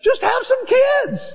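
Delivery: put-on "kids" yelled high and slow; shouted high. Shown as a demonstration. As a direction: shout "Just have some kids". Repeated louder.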